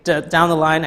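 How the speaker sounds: ordinary talk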